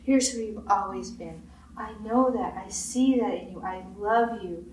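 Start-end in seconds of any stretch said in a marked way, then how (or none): none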